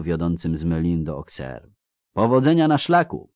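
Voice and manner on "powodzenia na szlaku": high-pitched call to a departing traveller; called-out farewell. A narrator's reading. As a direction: wish her well in a raised, high voice. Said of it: "krzyknęła jej w ślad Teresa Lapin".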